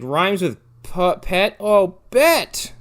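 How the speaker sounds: sudden realization. Retold or imitated. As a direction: work it out slowly, then pop with glee. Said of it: "She's like".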